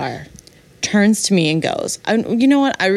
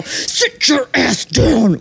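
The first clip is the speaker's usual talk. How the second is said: drawn-out, higher scolding command; mom's voice; 5 semitones higher, much slower than usual. Retold or imitated. Telling off her date in the restaurant, projected mom voice. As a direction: project like a mother laying down the law. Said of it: "my mom's voice came out of me".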